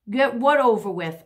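ordinary delivery

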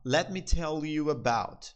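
slow, clear English pattern; slow demo. Shown as a demonstration. Teacher showing students the pattern, slowly. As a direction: model it slowly. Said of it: "sempre que tu quiser falar assim".

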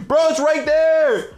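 slow, high exasperated plea; drawn out in disbelief; panicking gamer. Real, not acted. Teammate can't see item.

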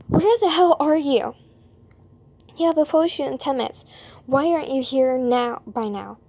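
loud, fast scolding over the phone; angry phone scolding. Acted. Manager on the phone yelling he is late for the shoot, loud and fast. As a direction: chew him out for being late.

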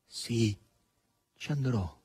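quiet, plain yes with a pause; simple assent. A narrator's reading. Margherita consents to Asasello, quiet and simple. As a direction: agree softly and simply. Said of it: "rispose semplicemente Margherita Nikolaevna".

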